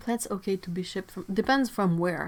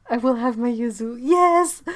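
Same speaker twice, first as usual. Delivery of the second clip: excited cheer; 5 semitones higher, 9 dB louder than usual. Real, not acted. Cheering for the yuzu tree.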